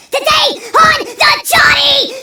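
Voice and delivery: loud, high, slow show-host shout; announcer sign-off. Acted. Host closing his show for the audience, loud announcer shout. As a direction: announce the show's name like a host.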